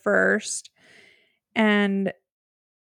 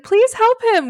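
high, loud plea to passers-by; imitated yelling; 12 semitones higher, 6 dB louder than usual. Yelling at locals to help him, imitated shout. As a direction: mimic an urgent yell for help. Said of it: "yelling at locals".

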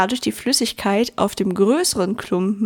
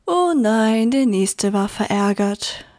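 ordinary delivery

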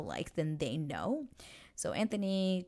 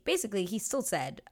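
normal talking voice

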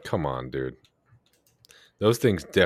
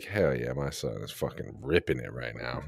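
proud dad voice; 6 semitones lower, 9 dB quieter than usual. Retold or imitated. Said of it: "Any dad would be like".

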